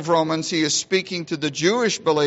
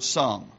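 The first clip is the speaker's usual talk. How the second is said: "some" set apart, low and soft; stress on "some"; 6 semitones lower, 5 dB quieter than usual. Shown as a demonstration. Preacher singling out a word of Paul's, stress on "some". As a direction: drop softly onto the word "some". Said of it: "I want to emphasize that word".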